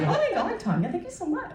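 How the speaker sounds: a touch of fake delight; mock delighted. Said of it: "And I said".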